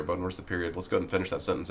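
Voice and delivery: ordinary talk, nothing marked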